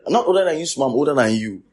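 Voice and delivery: high, loud teasing denial; jokingly loud protest; loud mock protest. Real, not acted. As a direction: fire back the denial playfully. Playful banter about age.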